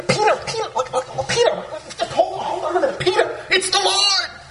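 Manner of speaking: high, breathless excited call with laughter. Retold or imitated. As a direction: call out to Peter, bursting with joy. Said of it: "I can imagine he's all excited".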